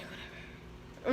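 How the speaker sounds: slow whispered shrug-off; resigned mutter. Retold or imitated. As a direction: whisper it, giving up on the argument. Retold resigned letting it go.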